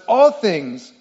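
"All" punched loud at the front; front-loaded loud stress on "All"; emphatic first words. Shown as a demonstration. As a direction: hit "All things" hard before easing off. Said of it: "these words are in the emphatic position".

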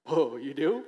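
a sudden loud startled whoa. Acted. Startled listener answering the mind reader, loud and surprised. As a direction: jolt back, caught off guard.

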